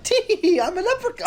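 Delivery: squeaky high mock leprechaun; silly leprechaun voice. Friend mocking the Ellimist's advice, squeaky high leprechaun voice. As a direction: pipe it up way high like a cartoon leprechaun.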